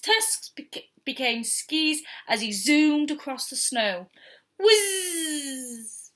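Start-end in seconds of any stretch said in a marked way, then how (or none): 4.6-6.1 whizz effect. Acted. Sound effect of zooming.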